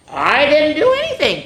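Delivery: high, innocent protest; leaf voice. A narrator's reading. Pointy answering Paige, lightly voiced high leaf. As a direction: insist it's not your fault. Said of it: "Pointy answered".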